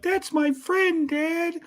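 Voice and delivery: put-on high, slow, whiny kid voice; high, drawn-out mock sob voice; mock whiny Baki. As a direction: whine it like a hurt little kid. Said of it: "And Baki's like".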